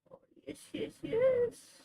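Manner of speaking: high, very soft coo rising; doting pet-owner coo. Real, not acted. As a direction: coo softly to the cat. Cooing to cat.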